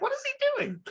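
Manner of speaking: sudden very high, incredulous "What is he doing?"; baffled squeak. Retold or imitated. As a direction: squeak it out in disbelief while laughing. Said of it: "I'm like".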